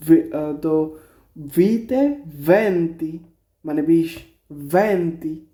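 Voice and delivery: ordinary talk, nothing marked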